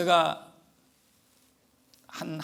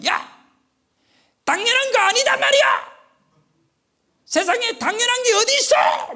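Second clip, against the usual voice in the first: loud, very high mock scream; imitated furious yelling; 10 dB louder than usual. Preacher imitating angry woman yelling into her phone, furious shout. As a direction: yell it like an outraged caller, full force. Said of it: "소리를 지르면서".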